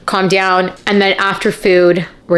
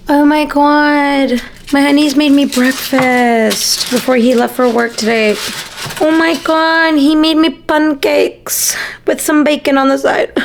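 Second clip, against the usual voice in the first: high, drawn-out delighted gushing; excited; 6 semitones higher, slower than usual. Real, not acted. Thrilled to viewers about her boyfriend's breakfast. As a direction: gush over the pancakes.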